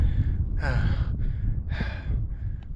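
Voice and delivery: long breathy exhale; tired sigh. Real, not acted. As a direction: sigh it out, spent. Exhausted runner needing to sit.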